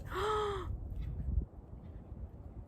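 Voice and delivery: sharp breathy gasp in awe; a real gasp at a lovely surprise; long gasp of delight. Real, not acted. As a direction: catch your breath, amazed. Opens etched deck.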